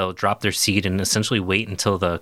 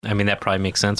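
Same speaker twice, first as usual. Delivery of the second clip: ordinary talk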